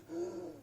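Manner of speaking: sharp, high startled gasp; acted gasp. Camper peeking out of his tent, startled gasp. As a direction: suck in air in mock alarm.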